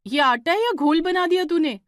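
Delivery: exasperated elder sister's rebuke; angry scolding. Acted. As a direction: snap at her for ruining the dough. Divya scolds Shweta over dough.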